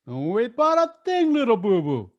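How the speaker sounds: playful bear voice to his little friend; hearty cartoon bear, reined in; Yogi Bear impression. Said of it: "Yogi always had that bigger than life persona".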